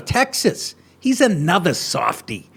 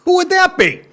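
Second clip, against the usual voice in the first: much higher, mock wide-eyed; sarcastic mock question; 12 semitones higher than usual. Host mocking the clip's line, sarcastic and higher. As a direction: play dumb with heavy sarcasm.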